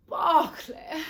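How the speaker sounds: high, loud cry of ecstasy; swooning cry. Acted. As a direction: cry out the lover's name, carried away. Swept away remembering her lover.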